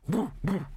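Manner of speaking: high imitated bark; dog bark imitation. A narrator's reading. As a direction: mimic a dog barking. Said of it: "complicated barks like".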